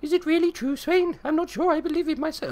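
very high-pitched, silly character voice. Acted. Game player voicing a doubter questioning Swain, silly high voice. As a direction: put on a squeaky, hammed-up high voice. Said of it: "the silly voices, they don't work".

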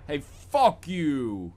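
lightly voiced cockney curse; half-hearted Michael Caine yell; Michael Caine outburst. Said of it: "You almost said "fuck you" and Michael Caine".

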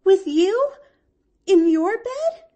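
put-on overjoyed child's cry; rapturous. A narrator's reading. Girl delighted to sleep in her aunt's bed, rapturous. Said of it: "she cried rapturously".

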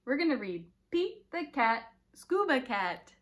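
bright, lively storytime voice; reading to children. A narrator's reading. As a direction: read aloud to little ones with energy. Reading picture book to children.

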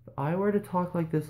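showing off the highest comfortable voice. Shown as a demonstration. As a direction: push the voice up as high as it goes without straining.

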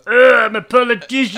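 high, loud mock politician voice; a silly put-on politician voice; hammed-up generic politician. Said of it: "You go".

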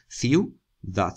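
CIU... DAD, two separate beats; split into syllables. Shown as a demonstration. Teacher sounding out syllables for students, Ciudad split as ciu... dad. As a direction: break Ciudad in two with a clear pause. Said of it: "Suenan las dos sílabas".

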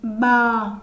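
loud, clear "Bờ" drawn out for the class; modeling the sound bờ. Shown as a demonstration. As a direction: say "Bờ" slowly and distinctly as a model. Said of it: "Nghe cô phát âm".

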